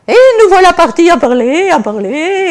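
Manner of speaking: high, loud excited telling. Real, not acted. Preacher telling of chatting with the shoemaker, excited. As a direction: burst out delighted. Laughing at meeting a protestant.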